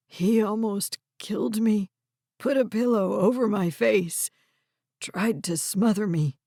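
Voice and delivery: a hint of a scared grandfather recalling it; shaken old man. Red tells Harper his grandson tried to smother him, lightly voiced frightened old man.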